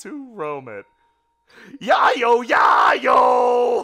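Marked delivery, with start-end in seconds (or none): 1.8-3.8 hammed-up pirate hollering, much higher and louder; playful pirate cheer.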